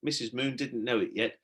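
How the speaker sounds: ordinary delivery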